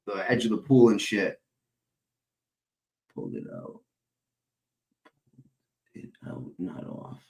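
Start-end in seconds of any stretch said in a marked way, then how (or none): 3.1-7.2 hushed whisper. Real, not acted. Hushed aside.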